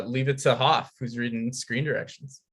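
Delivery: ordinary talk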